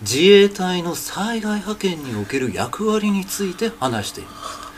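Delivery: slow, sticky Ishiba Shigeru drawl in own voice; teacher shows Ishiba's slow oily way of speaking; Ishiba Shigeru manner. Shown as a demonstration. 石破茂風にゆっくりねっとり. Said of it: "このいやらしい感じの喋り方".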